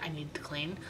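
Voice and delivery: a soft unvoiced whisper to herself; murmured note to self; whispered aside. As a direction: whisper it like a guilty confession. Quoting herself as a private aside.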